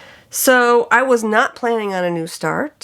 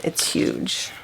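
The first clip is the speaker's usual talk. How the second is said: hushed aside; 7 dB quieter than usual. Real, not acted. Showing off big linen piece.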